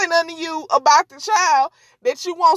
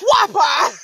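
high, sudden whack sound; imitated smack noise; 5 semitones higher than usual. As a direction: voice the impact as a comic whop. Imitates the computer hitting him.

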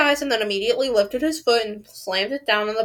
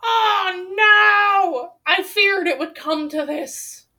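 long, high-pitched wailed cries; dramatic wailing; 11 semitones higher, 6 dB louder, much slower than usual. Real, not acted. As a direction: wail it out in drawn-out dismay. Reader reacts to the story's turn.

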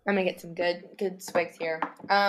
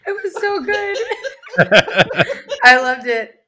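laughing; 12 semitones higher, 11 dB louder than usual. Real, not acted. Cracking up after ASMR read.

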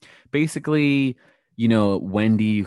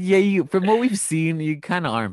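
laughing retort; 9 semitones higher, 3 dB louder than usual. Retold or imitated. Laughing retort to Candace's claim.

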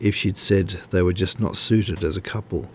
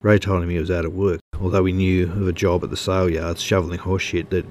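usual speaking voice

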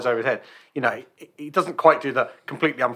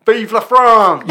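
slow, high, loud mock battle cry of Vive la France; hammed-up rallying shout, drawn out; 7 semitones higher, 11 dB louder, much slower than usual. Film soldier charging the battlements, hammed-up high shout.

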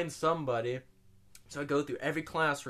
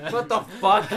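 loud, high baffled exclamation; retold loud outburst of confusion; 6 semitones higher, 10 dB louder than usual. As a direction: blurt it out in disbelief. Retold reaction to odd combination.

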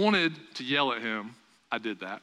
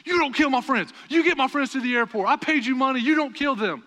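loud, much higher scolding; retold yelling; 8 semitones higher, 4 dB louder than usual. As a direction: yell down the phone at the reckless driver. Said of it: "he just starts yelling at him".

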